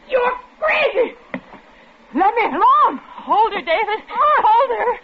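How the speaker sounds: high desperate yells; frantic shouting. Acted. Wife yells to husband while struggling with the madwoman. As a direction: shout for your life.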